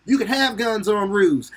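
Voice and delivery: high, twangy Pennsylvania yokel voice, hammed-up; Pennsylvania accent. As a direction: play a Pennsylvania local with a put-on accent. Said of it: "how people in Pennsylvania sound".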